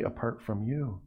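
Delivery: "apart from you" set off softly; weight the phrase "apart from you" by hushing it; stress on YOU phrase. Shown as a demonstration. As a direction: drop softly and deliberately onto "apart from you". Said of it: "That's where the emphasis is".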